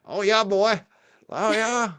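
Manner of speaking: high-pitched, loud country accent; Byfield country accent. Shown as a demonstration. Showing off the hometown country accent, Byfield country voice. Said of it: "this real Byfield, uh, uh, like, uh, country".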